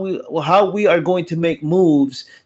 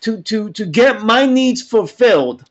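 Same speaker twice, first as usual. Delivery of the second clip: preaching rant; 7 semitones higher than usual. Real, not acted. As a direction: preach it like a sermon.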